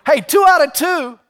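high, loud crowing over a win; proud owner cheering his dog; triumphant. Acted. Joke: owner crows over dog's answer.

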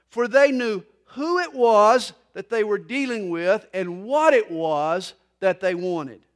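loud, emphatic pulpit delivery; preaching. Real, not acted. Pastor explaining the blind men's plea for mercy, emphatic preaching. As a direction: drive each point home loudly.

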